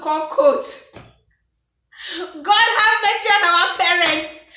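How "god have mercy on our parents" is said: loud, high cry of God have mercy; mock exasperated outcry. Real, not acted. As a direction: throw up your hands and cry it out. Exasperated outcry about parents.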